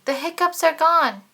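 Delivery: high, loud happy announcement; triumphant reveal. A narrator's reading. Narrator tells children the hiccups are finally gone, triumphant. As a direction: celebrate the cure.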